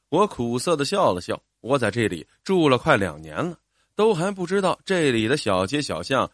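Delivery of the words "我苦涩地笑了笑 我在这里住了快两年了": plain reading voice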